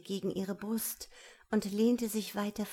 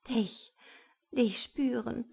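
higher, yearning woman's voice; halting plea; 4 semitones higher than usual. A narrator's reading. Woman answers the man's question, haltingly pleading. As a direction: plead in broken phrases.

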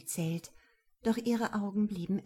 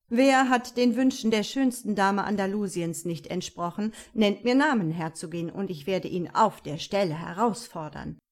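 louder, sticky-sweet gallant; Don Ricardo, sticky sweet; 5 dB louder than usual. Acted. Rival nobleman flattering the duchess, sugary and false. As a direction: flatter the duchess with honeyed, two-faced charm. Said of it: "Don Ricardos Stimme war so, klebrig, süß und auch noch doppelzüngig".

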